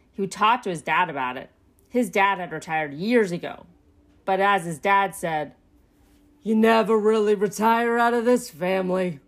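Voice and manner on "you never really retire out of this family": firm dad character voice; a father laying down family truth; fatherly raccoon. A narrator's reading. Dad explains the family business to his son, fatherly.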